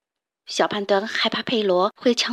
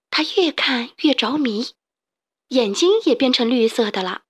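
ordinary delivery, nothing marked

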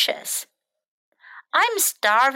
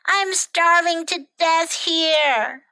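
high, hammed-up misery; plaintive storybook whine; whimpering complaint; 6 semitones higher, 3 dB louder, slower than usual. A narrator's reading. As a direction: moan it like a starving little bird. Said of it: "whimpered Bird".

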